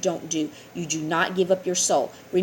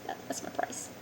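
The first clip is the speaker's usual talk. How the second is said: a sly, sinister whisper; devil's whisper; 14 dB quieter than usual. Acted. The devil whispering his price for a wish, sinister. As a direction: breathe the price like a secret threat.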